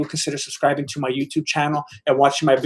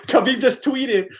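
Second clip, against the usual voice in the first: laughing; 7 semitones higher, slower than usual. Real, not acted. Laughing at Khabib's tweet.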